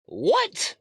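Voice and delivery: squeaky high disbelieving what; incredulous what. Real, not acted. Ranter reacts to the mask idea with a high, incredulous what. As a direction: shoot the pitch way up in disbelief.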